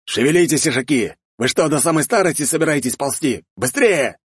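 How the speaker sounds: high, impatient shout at the men; commanding yell. A narrator's reading. Count spurs his riders on, yelling impatiently. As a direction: yell orders to hurry the column. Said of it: "заорал мужчина, подстегнув своего коня".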